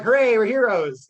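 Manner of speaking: loud proud cheer; triumphant hooray. Acted. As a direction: crow over the victory. Heroes celebrate the kill.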